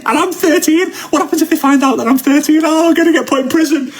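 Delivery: high panicky worry; mock-panicked thirteen-year-old; panicked kid. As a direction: freak out in a small voice. Panicked kid fearing being caught.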